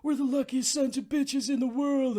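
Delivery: high, exuberant mock movie quote; hammed-up boyish boast, pitched high; cheesy movie character. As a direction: crow it with cheesy glee. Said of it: "it's so cheesy, the scenes between him and Jack".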